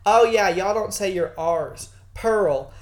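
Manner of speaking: imitated Southern country twang, hard R; country accent. Lady correcting the British friend's R's, country accent. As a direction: put on a country drawl with a strong R. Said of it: "country-ass Pearl".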